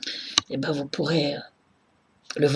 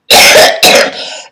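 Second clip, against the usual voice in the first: loud, harsh real cough; hard coughing fit; 15 dB louder than usual. Real, not acted. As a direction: cough hard, breaking off mid-speech. Ill, coughing fit while talking.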